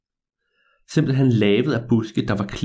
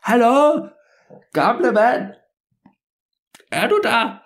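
high, raised shout for someone out of sight; teenage boy hollering high; calling out; 12 semitones higher, slower than usual. Acted. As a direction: call over a tall hedge. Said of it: "stak riven op i luften og råbte".

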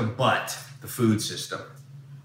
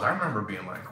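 ordinary talk

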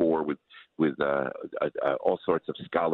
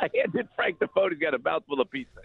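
laughing; 10 semitones higher than usual. Real, not acted. Laughing while handing over phone.

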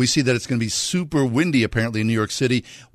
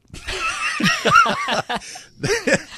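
big laugh. Real, not acted. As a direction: laugh out hard at the memory. Laughs at the story.

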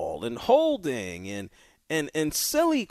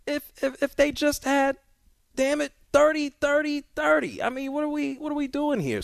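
exasperated rant; 13 semitones higher than usual. Real, not acted. Host exasperated by missed kick.

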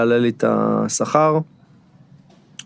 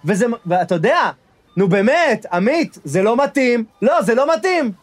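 high, rising indignant protest into the phone; outraged, getting shriller as he's ignored; indignant pleading; 9 semitones higher than usual. Acted. Guest protesting to his boss on the phone, high indignant pleading.

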